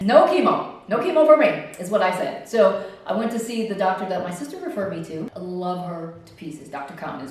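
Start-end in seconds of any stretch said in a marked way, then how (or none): 0.0-1.5 loud, raised-pitch declaration; emphatic refusal. Retold or imitated. Patient turns down chemo, emphatic. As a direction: put your foot down loudly.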